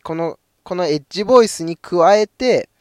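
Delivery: ordinary talk, nothing marked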